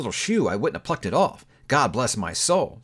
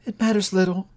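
ordinary delivery, nothing marked

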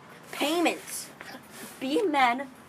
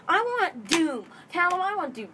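rude demand; 6 semitones higher than usual. Pretend. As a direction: blurt it out like a pushy buyer. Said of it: "Don't be like that".

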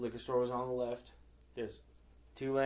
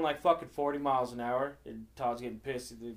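ordinary talk, nothing marked

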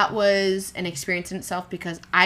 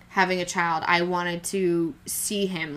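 usual speaking voice

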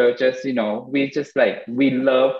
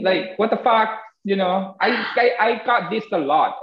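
higher-pitched aggrieved complaint; exasperated venting; 6 semitones higher than usual. Retold or imitated. Podcaster voicing complaint to a backstabbing friend, exasperated. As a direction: pour out a pent-up grievance, higher.

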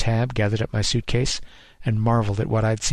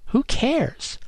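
dismissive retort. Acted.

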